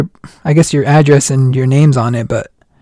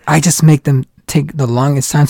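ordinary delivery

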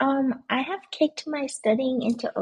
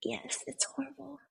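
whispered; 2 semitones higher, 10 dB quieter than usual. Real, not acted. Complaining about long workday.

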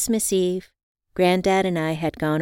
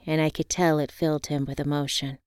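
ordinary delivery

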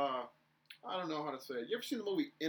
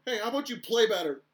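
loud, higher taunting comeback; retold shout back; 8 semitones higher, 10 dB louder than usual. Scorekeeper snapping back at the chirping player, loud taunt. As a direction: fire it right back at him. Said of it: "I looked him dead in the eyes and go".

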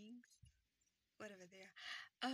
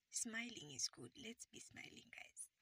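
whisper; 2 semitones higher, 10 dB quieter than usual. Acted. Soft aside to listeners.

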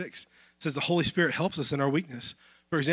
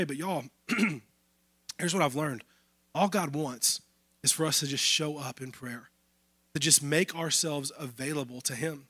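usual speaking voice

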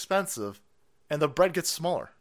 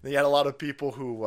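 ordinary talk

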